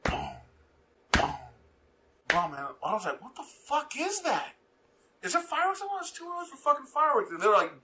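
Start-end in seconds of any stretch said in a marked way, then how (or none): 0.0-2.5 imitated deep booms. 3.2-7.3 retold bewilderment. Retelling puzzled reaction to booms.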